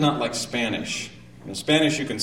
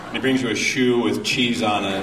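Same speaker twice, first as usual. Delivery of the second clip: usual speaking voice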